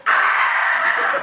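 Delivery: very high breathy yelp of terror; high fright shriek. Acted. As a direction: squeal and bolt. Second son screams.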